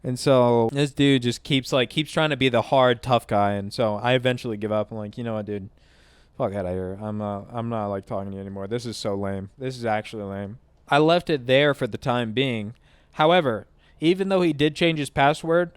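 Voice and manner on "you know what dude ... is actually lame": softer, lower tired brush-off; lower, softer disgusted dismissal; dismissive. Retold or imitated. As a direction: quietly wave him away. Giving up on the argument.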